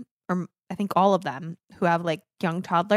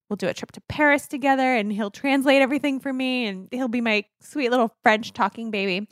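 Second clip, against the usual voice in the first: usual speaking voice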